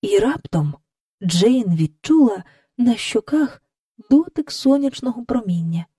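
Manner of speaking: ordinary delivery, nothing marked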